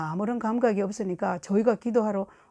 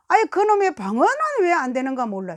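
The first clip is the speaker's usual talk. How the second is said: exasperated grumble; 5 semitones higher, 5 dB louder than usual. Retold or imitated. Acted frustrated complaint about tongues.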